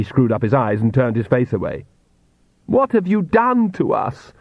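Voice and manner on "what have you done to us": high, slow anguished scream at modest volume. A narrator's reading. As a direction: wail it accusingly, pitch way up. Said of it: "Lacrimosa screamed".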